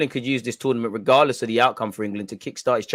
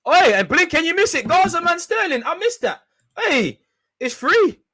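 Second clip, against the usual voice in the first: shrill squeaky shouts at the telly, much higher and louder; high excited yelling; 6 dB louder than usual. Real, not acted. Fan yelling at England players on TV, high excited shouts. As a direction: yell at the screen in a strained high voice.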